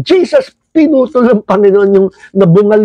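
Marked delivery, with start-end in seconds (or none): 0.0-0.5 high cry of Jesus; shouted name. Retold or imitated. Wounded man crying Jesus in pain, high shout. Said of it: "yung sigaw yung".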